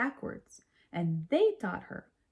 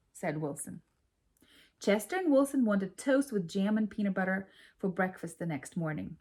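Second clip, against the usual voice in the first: ordinary delivery, nothing marked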